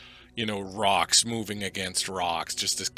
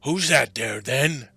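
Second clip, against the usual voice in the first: louder, suspicious creature voice; Boulder challenging; 4 semitones higher, 6 dB louder, slower than usual. Acted. Boulder gets up to challenge an intruder, loud suspicious creature voice. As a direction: demand it loudly as a roused rock giant. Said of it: "and he's like".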